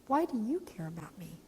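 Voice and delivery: breathless whisper; whispered question. Retold or imitated. Girl whispering to Rhonda, unsure why she cares. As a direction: ask it barely voiced.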